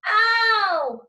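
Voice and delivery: high, loud pained shriek. Acted. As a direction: yelp it out in pain. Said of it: "Lexi shrieked".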